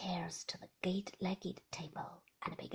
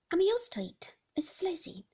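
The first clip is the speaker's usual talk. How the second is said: higher, smiling nurse reply; 7 semitones higher, 3 dB louder than usual. Acted. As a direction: say it through a smile. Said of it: "smiled the nurse".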